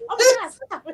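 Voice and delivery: high, loud laughing protest; laughing shout. Retold or imitated. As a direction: squeal it out through laughter. Vibrator teasing, laughing protest.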